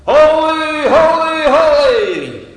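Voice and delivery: a big, high, ringing shout of holy; high loud proclaiming chant; angels shouting. Acted. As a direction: cry it out like angels round the throne. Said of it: "they're shouting".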